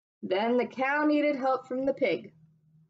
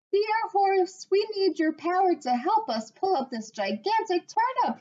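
pig calling to horse; 8 semitones higher than usual. A narrator's reading. Pig calls horse for help in high voice. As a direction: squeal out the plea to the horse. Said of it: "he cried".

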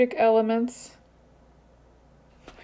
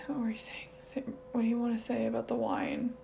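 whisper; 9 dB quieter than usual. Real, not acted. Softly asking the spirit.

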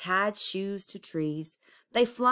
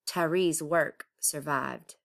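ordinary delivery, nothing marked

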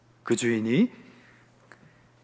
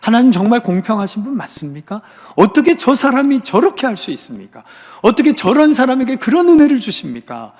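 high, rising complaint to God; acted resentment, building louder with each question; aggrieved protest; 6 semitones higher, 5 dB louder than usual. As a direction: protest indignantly as a jealous believer. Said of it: "악하게 보기도 합니다".

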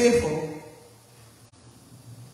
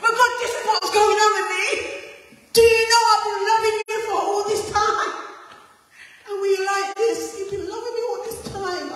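much higher, fast, complaining plea; Elijah pleading with God; 10 semitones higher, 5 dB louder, faster than usual. Acted. Prophet pleading with God to be left alone in the cave. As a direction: whine it out like a worn-out prophet. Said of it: "And Elijah started to talk".

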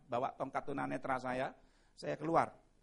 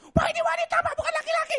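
high, loud shocked shout; a woman screeching at a man in her toilet; shrieked alarm, much higher and louder; woman yelling; 11 dB louder, faster than usual. Retold or imitated.

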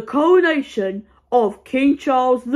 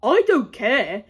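normal talking voice

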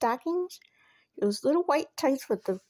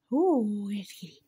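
ordinary delivery

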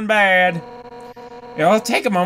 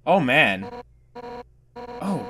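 usual speaking voice

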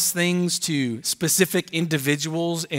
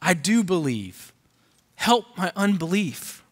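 lightly voiced pleading father, higher at the end; father crying out; 3 semitones higher than usual. A narrator's reading. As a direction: let the father's cry lift toward Help, but keep it restrained. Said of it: "the boy's father cried out and said".

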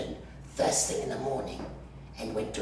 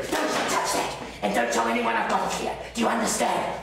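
higher and louder, furious warning; angry shout; 11 semitones higher, 7 dB louder than usual. Acted. Orderly shouting at nosy coworker Troy, furious. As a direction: bark at him to keep his hands off.

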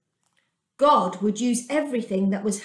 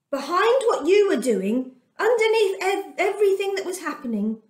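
earnest comfort; 8 semitones higher than usual. A narrator's reading. Joseph comforting his frightened brothers.

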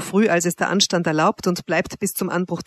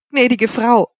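loud, raised, indignant exclamation; 5 semitones higher, 7 dB louder, slower than usual. A narrator's reading. Man protesting to his mistress, indignantly. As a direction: protest as an offended man, loudly. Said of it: "rief ich empört".

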